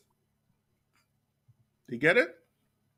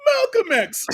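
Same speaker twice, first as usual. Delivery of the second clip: "Malcolm X" much higher and louder, drawn out; imitating Yemeni brother; 6 dB louder, slower than usual. Retelling the shop brother's punchline, much higher and drawn out. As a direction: sing out the name high like the brother did. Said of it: "That's how he said it".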